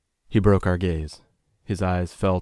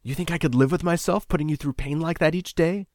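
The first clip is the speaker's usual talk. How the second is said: angry; 11 semitones higher, 5 dB louder than usual. A narrator's reading.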